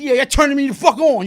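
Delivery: loud, high, goading delivery; retold taunting. Inmate provoking the men beating him, loud retold taunt. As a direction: goad them loudly as if enjoying it.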